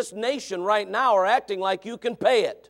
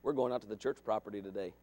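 put-on much lower, softer sly kid; a boy with a scheme; quiet, much lower kid voice; sly son; 12 semitones lower, 12 dB quieter, faster than usual. Voicing son.

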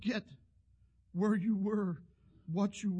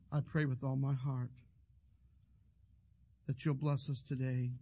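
lowered, earnest prayer voice; 3 semitones lower than usual. Acted. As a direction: pray aloud, leading the congregation. Preacher leads prayer.

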